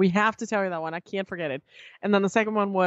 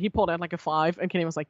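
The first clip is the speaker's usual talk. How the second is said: ordinary talk, nothing marked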